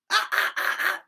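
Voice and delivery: loud unvoiced fry scream. Shown as a demonstration. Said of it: "the fry scream on there".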